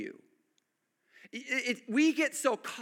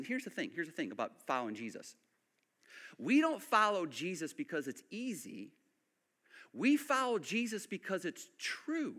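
ordinary delivery

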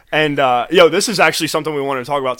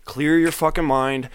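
calming self-talk; 3 semitones lower, 6 dB quieter than usual. Retold or imitated. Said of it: "I fucking, like".

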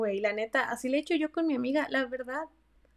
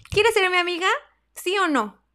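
louder and higher, playful and direct; loud bold ask; 7 semitones higher, 5 dB louder than usual. Retold or imitated. Asking a gym stranger to be friends, louder. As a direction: put it to her straight, loud.

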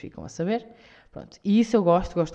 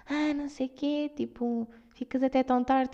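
higher, softer mock nagging voice; mock judgy nag; 6 semitones higher, 4 dB quieter than usual. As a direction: parrot the people who judge you. Imitating people judging late nights. (imitação de crítica, aguda e suave)

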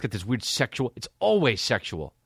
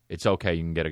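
normal talking voice

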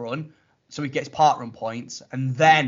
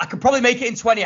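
rushed; 9 semitones higher, 5 dB louder, much faster than usual. Retold or imitated. Matt rushing, voiced.

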